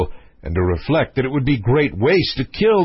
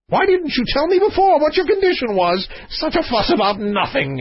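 high, exasperated monarch; exclaiming king; 12 semitones higher than usual. Acted. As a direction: scold him in a high royal voice. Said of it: "exclaimed the king".

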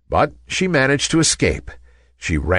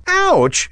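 high-pitched "Ouch!"; pained cry. A narrator's reading. Ballerina bumped by a handbag, crying out in pain. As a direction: yelp out a sudden ouch. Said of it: "she cried".

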